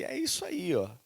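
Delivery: ironic high-pitched aside; high mock quip. Preacher retells his own remark.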